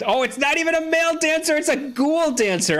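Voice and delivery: high, excited burst of discovery; high-pitched amazed exclamation; delighted surprise. Real, not acted. Surprised discovery of ghoul dancer.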